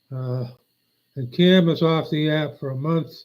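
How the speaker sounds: ordinary delivery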